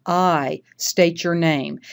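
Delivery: "I", held, then a pause before "state your name"; slow "I", stop briefly, then "state your name"; pause after I. Shown as a demonstration. Teacher showing the comma in the oath, pause after "I". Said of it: "You're pausing a little bit there".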